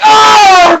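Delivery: very high, loud, sustained yell; mock pained shriek; comic scream. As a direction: howl as if slammed down, hammed up. Acted yell when slammed down.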